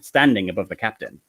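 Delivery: ordinary talk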